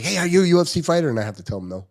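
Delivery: normal talking voice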